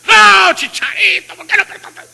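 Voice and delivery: a fan's frustrated yell at the television. Retold or imitated. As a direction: shout at the player on TV. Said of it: "le gritamos a la televisión".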